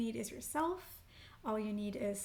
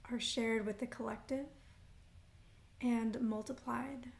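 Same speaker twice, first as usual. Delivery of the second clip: unhurried meditation guide with long pauses; guided meditation; 4 dB quieter than usual. Acted. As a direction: lead them gently into breath and intention, phrase by phrase. Leads grounding breaths and intention setting.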